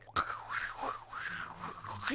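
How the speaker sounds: voiceless mimicked breathy sounds; imitated bedroom noises. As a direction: imitate someone's noises without words. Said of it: "she was making all types of sounds".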